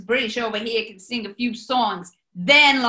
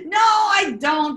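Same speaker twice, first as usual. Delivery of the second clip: slow, loud burst of denial; loud slow retort; sudden shout; 7 semitones higher, 5 dB louder, slower than usual. Acted. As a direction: bark it out, dropping the pretence. Boss drops the joke, bursts out.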